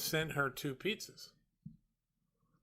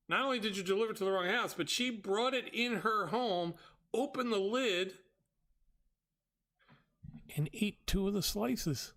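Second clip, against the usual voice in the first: high-pitched, emphatic list of offences; indignant rant; 5 semitones higher than usual. Retold or imitated. Customer scolding driver over wrong house, indignant. Ranting at the delivery mistake.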